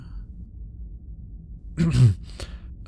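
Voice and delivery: self-conscious little ahem; embarrassed clearing of the throat; awkward throat-clear. Acted. Flustered after romantic line.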